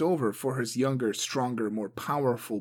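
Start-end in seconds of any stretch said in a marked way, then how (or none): none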